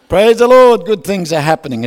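rally the room with a big shout; loud praise. Real, not acted. Preacher to congregation, shouting praise.

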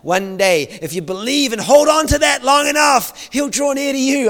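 ironic, hammed-up wistful tone; mocking the old view. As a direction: send up the fridge-magnet promise. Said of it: "little promise you'd put on your fridge".

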